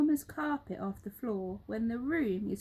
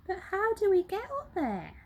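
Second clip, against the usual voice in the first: higher, lightly voiced anxious question; worried helpers; 10 semitones higher, 3 dB louder than usual. Helpers doubting they can reach, mildly anxious. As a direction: ask it unsure. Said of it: "they asked".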